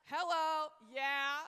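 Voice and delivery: loud, drawn-out hello and yeah; big sarcastic wake-up call; mock call-out. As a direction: holler it at the crowd, stretching each word.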